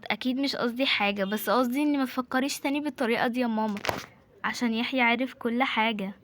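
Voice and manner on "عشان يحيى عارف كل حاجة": ordinary talk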